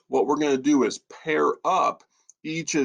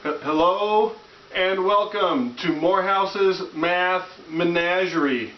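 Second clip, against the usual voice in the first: high, slow goofy presenter; nerdy host voice; 7 semitones higher, slower than usual. Acted. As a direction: play a dorky math show host. Self-introduction in nerdy host voice.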